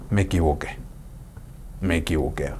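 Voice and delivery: hushed, humble me equivoqué; soft confession. Acted. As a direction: murmur it like a quiet confession to God. Admitting a mistake, confessing.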